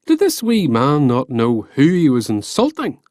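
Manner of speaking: usual speaking voice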